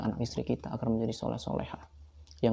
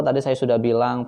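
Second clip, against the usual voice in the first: normal talking voice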